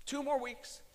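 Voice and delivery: fighting tears as he retells it; emotional. Real, not acted. Retelling his ultimatum to his wife, close to tears. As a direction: voice cracking high with held-back tears. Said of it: "I'm sorry, I'm so emotional".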